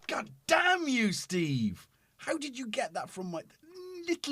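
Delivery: high, loud, slow hammed-up curse; mock outrage. Host mock-curses Steve for guessing right. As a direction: fake fury at a friend's lucky guess.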